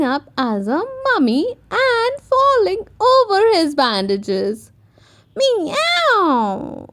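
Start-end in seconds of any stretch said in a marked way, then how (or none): 5.4-6.9 high playful cat meow; cat purr. A narrator's reading. Peppa as a witch's cat, a high playful meow. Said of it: "purred Peppa".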